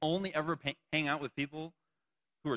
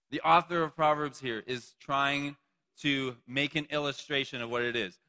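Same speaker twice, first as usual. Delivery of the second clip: normal talking voice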